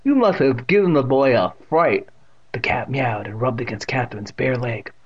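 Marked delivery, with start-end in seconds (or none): 0.0-2.1 put-on loud deaf old woman teasing her cat; Evan's deaf old aunt, playfully scolding. A narrator's reading.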